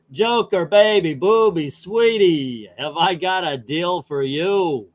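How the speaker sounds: lightly voiced slick showbiz devil, slow; a hint of a smooth talk-show sidekick. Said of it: "my best [name]".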